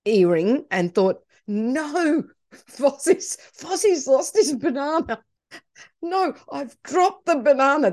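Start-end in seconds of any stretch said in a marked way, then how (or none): 1.5-7.9 mock panic. Realises figure lost banana.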